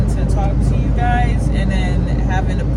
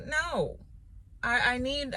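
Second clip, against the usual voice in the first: usual speaking voice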